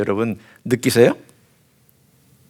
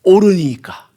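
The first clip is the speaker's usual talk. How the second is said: higher and louder 옳으니까, falling hard at the end; defiant retort; 7 semitones higher, 6 dB louder, slower than usual. Retold or imitated. Habakkuk snapping his final challenge at God, loud and defiant. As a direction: throw 옳으니까 back like a challenge. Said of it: "뭐라고 하나님께 대드냐면".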